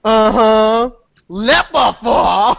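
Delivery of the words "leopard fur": drawn out exclamation. Pretend.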